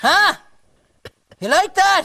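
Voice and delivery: high, loud gloating jeer; loud taunt. Acted. Mocking his pal after the smack, loud taunt. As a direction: crow over the hit, loudly.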